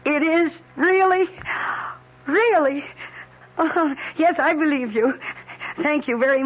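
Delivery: can hardly believe the good news; joyful surprise. Acted. Wife on the phone hearing she's pregnant, thrilled surprise.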